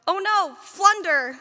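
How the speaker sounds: put-on slow, loud, high child's cry of alarm; loud, drawn-out kid voice calling out about thunder; little boy's yell. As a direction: yell it like a startled little boy. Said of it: "and Max yelled".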